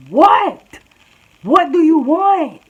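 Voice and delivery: high, loud, drawn-out retort; acted exasperated shout. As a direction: turn round and yell back, exaggerated. Turns round to answer the screamer.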